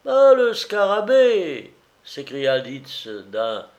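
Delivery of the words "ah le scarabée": high, loud, drawn-out jovial cry; cheerfully crying out in recognition; playful exclamation. A narrator's reading. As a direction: hail an old schoolmate with playful delight. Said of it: "ton enjoué".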